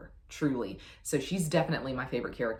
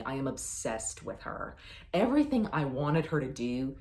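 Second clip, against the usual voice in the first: ordinary talk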